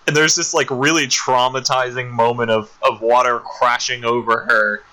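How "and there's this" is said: usual speaking voice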